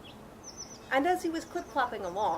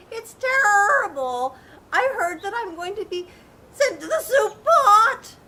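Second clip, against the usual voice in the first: panicked rooster; 10 semitones higher, 12 dB louder, slower than usual. Acted. Rooster fears the soup pot.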